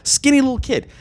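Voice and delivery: normal talking voice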